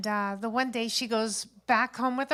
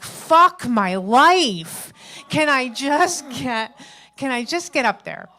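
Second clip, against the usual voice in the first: exasperated outburst; 2 semitones higher, 8 dB louder, slower than usual. Acted. Frustrated waiting at the DMV.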